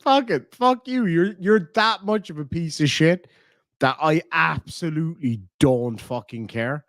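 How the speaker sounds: defiant dismissal. Retold or imitated. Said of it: "I'm like".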